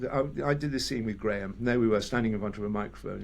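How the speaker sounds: ordinary talk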